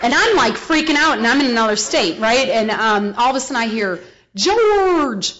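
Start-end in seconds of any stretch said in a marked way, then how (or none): 4.3-5.3 higher, louder call across a distance. Retold or imitated. Old man running down the road, calling George loudly. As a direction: call his name down the road.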